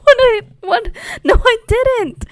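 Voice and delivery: high, loud, indignant protest; retold kid protesting her innocence, loud and high; shocked denial. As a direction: blurt it out in disbelief.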